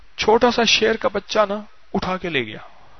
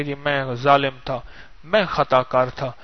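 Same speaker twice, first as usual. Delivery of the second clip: lowered, subdued admission of guilt; penitent confession; 4 semitones lower, 6 dB quieter than usual. Acted. Penitent man confesses to the king, low and remorseful.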